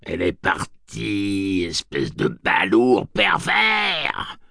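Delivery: slow, high, bawled witch screech; witch bawling. Acted. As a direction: screech it like a cackling hag. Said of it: "brailla Réa Ducos".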